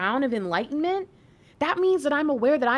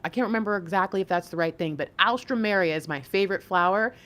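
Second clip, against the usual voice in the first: usual speaking voice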